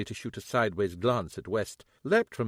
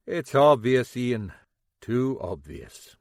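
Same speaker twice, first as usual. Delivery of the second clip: unimpressed; 6 dB louder, slower than usual. Acted. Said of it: "he said, unimpressed".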